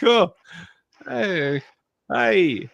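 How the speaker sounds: a playful Braveheart-style Scot; mock Scottish accent. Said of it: "You know that scene in Braveheart?".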